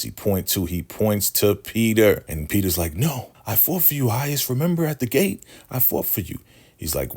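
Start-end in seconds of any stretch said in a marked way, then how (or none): 2.9-6.4 much higher mock pleading; desperate begging. Peter reminding the highest he fought for him, begging. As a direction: plead for your life with the leader.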